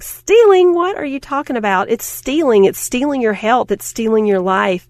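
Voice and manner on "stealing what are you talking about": incredulous. Retold or imitated. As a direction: gasp out the word in disbelief. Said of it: "you're like".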